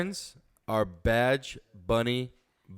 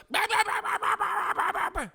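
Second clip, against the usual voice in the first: high, shrill-pitched bah bah babbling; mock Karen babble. Mocking a Karen yelling at cops, high mock babble. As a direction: ham up a ranting woman's nonsense. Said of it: "the woman goes out and goes".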